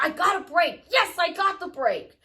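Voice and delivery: high, loud delighted yes; excited cheer. Retold or imitated. Woman retelling her joy at a break, high bright cheer. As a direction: cheer at finally getting a break.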